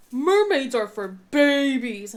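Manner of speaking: put-on slow, loud taunting bully kid; drawn-out schoolyard taunt; mocking kid. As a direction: sneer it like a rude schoolboy.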